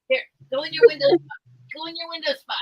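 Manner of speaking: high coaxing voice for the cat; coaxing pet voice. Real, not acted. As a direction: urge the cat gently.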